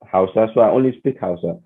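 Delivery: ordinary delivery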